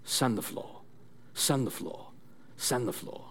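quiet, low, clipped-vowel sensei command; Mister Miyagi. Acted. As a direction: softly intone it like an old karate master. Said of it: "then Mister Miyagi says".

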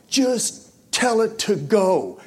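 slow, high urging cry; retold yell. Wanting to yell at his praying friend, retold yell. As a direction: yell it inwardly, stretched and high. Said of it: "I, I wanna yell".

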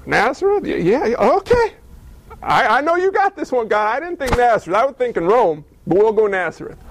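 high, eager angel voice, caught off guard; flustered angel Gabriel. Acted. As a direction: play Gabriel nervously trying to keep up. Gabriel answers God, flustered.

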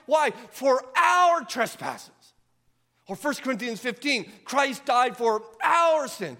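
loud, high preaching at full force; excited full-voice sermon climax; yelling preacher. Real, not acted. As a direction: preach it out, near shouting. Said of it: "That's why I'm yelling".

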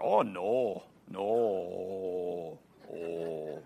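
"no" stretched endlessly on a long low O; drawn-out Wisconsin "no". Shown as a demonstration. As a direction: drag out the O in no forever. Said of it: "I could make the word no sound forever".

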